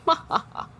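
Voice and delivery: imitated husband's laugh, high and airy; mock laugh. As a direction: imitate a dismissive "ha ha ha". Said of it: "like, laughs, like".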